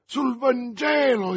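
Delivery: high, drawn-out cry of an oath; desperate exclamation. A narrator's reading. D'Artagnan swears to the dying man, desperate exclamation. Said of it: "esclamò D'Artagnan".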